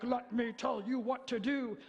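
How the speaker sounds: lightly bossy, telling-you-what-to-do; a hint of a domineering parent. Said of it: "Not authoritative like".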